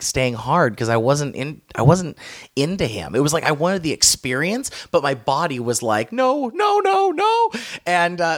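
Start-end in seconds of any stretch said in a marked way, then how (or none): 6.1-7.5 high-pitched string of refusals; comic high protest, climbing higher; mock refusal. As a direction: squeal it as a body saying no. Voices his body refusing.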